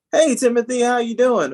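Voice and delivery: high, proper office hello; mock polite greeting. Coworker switching register to greet Timothy, mock polite. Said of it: "they turn the, the, uh, to Timothy and they say".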